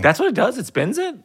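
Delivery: high, disbelieving questions; incredulous. Real, not acted. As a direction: sound amazed he means it. Incredulous at brother's answer.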